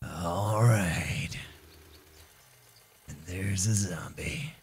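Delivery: hushed, rasping movie tough guy. Pretend. Player sizing up a zombie in his path, tough guy voice. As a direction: whisper it like an action hero. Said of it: "tough guys talk like that".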